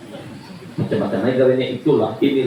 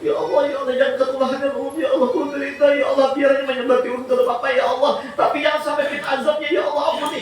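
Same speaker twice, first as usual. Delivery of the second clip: anguished plea; 9 semitones higher, much faster than usual. Acted. Parent prays in distress.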